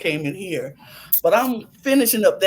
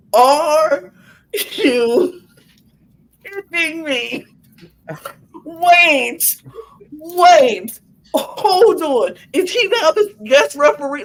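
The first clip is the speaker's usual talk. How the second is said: high, loud, stunned disbelief; shocked disbelief; 6 semitones higher, 3 dB louder, much slower than usual. Retold or imitated. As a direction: react like you can't believe the twist. Shocked at CM Punk as referee.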